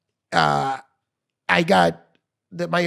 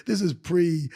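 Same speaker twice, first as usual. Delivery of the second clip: ordinary talk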